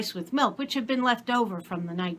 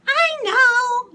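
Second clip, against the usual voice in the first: put-on very high, loud child voice; little girl's voice; 8 dB louder than usual. A narrator's reading. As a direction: squeal like a small girl.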